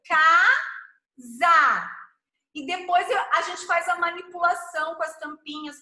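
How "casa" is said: louder casa, each syllable marked; syllable-counting demo. Shown as a demonstration. Teacher says casa so the child counts the mouth openings. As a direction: open the mouth clearly on each beat of casa. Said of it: "quantas vezes a gente abriu a boca".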